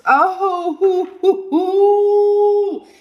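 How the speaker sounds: high, long "hoo" whoops; delighted whooping. Real, not acted. Tarot reader hits the jackpot card, delighted whooping. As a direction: whoop high and hold the last one.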